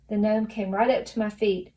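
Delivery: usual speaking voice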